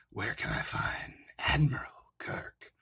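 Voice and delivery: slow, menacing whispered villain; Ricardo Montalban. Pretend. Hunting for Admiral Kirk, a slow menacing villain whisper. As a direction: breathe the question out slowly like a sinister villain. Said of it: "a Ricardo Montalban impression".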